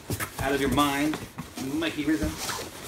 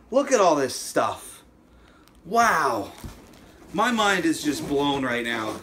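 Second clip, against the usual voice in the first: ordinary talk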